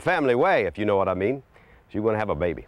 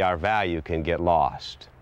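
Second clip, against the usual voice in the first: ordinary talk, nothing marked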